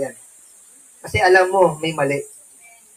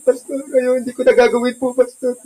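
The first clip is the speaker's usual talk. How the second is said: fake crying plea; 3 semitones higher than usual. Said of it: "iyak pa kunwari kay Pastor".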